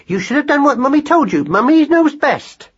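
very high, squeaky maternal voice; chiding mother. A narrator's reading. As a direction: tell the little one off fondly. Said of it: "dealing with the matter as mothers do".

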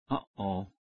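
low, soft murmur full of dread. A narrator's reading. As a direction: breathe it out, sensing trouble. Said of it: "I murmured".

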